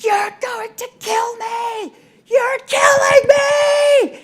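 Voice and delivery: high, slow, piercing shout; retold screaming. A brother screaming at his sibling, imitated in a desperate high shriek. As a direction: scream it like a boy in agony. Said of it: "the kinds of screams that came out of my brother".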